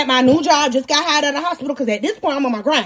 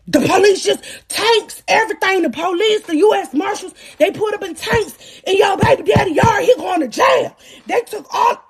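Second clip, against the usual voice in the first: high, urgent phone report; worked-up, pitched-up retelling of a caller; frantic caller; 5 semitones higher than usual. Retold or imitated. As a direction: blurt out the bad news in a panic.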